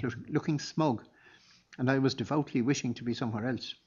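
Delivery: ordinary delivery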